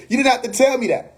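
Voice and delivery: ordinary talk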